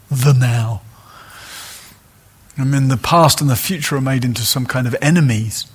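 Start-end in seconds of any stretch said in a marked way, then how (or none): 0.1-0.8 louder, ironic "The now." with weight on "The"; mock grand. Shown as a demonstration. Meditation teacher mocking the cliché of "the now", louder and ironic. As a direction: say "The now." as a slightly pompous cliché.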